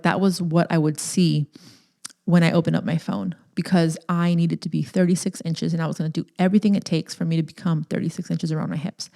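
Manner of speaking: ordinary talk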